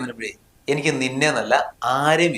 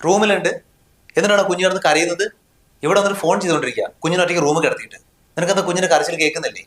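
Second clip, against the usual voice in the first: higher-pitched worried scolding; 5 semitones higher than usual. Acted. Husband scolding wife for leaving the baby alone, raised and alarmed. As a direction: tell her off, alarmed and raised.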